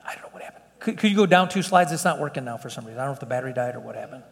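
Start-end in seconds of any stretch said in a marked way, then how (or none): 0.0-0.6 breathy whispered aside to himself, no voice at all. Real, not acted. Preacher to himself when the slides freeze, whispered. Slides fail mid-sermon. 1.9-4.2 fast, softer muttering under the breath; quick muttered aside. Real, not acted. Preacher to the slide operator about the dead battery, quick mutter. Slides fail mid-sermon.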